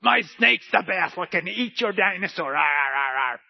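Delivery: put-on loud, high-pitched proud kid showing off a clay snake; hammed-up childish gloating; boasting child. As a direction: brag like a child, ending in mock roars. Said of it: "and you're like".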